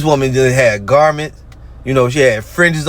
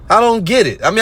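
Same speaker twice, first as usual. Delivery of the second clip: ordinary delivery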